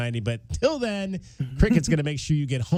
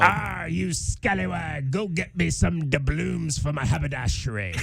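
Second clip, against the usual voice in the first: pirate voice; 4 semitones higher than usual. Pretend. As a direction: play a cheesy pirate. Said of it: "So I'm gonna give you my best now".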